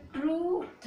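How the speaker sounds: high, slow "ट्रूथ", long oo; sounded out. Shown as a demonstration. Teacher builds the word for learners, sounded out "ट्रूथ". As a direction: stretch "ट्रू", then add a separate th. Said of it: "क्या बोलोगे".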